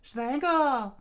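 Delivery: high, loud attack shout; screamed attack. Acted. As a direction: scream the move. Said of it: "she screamed out".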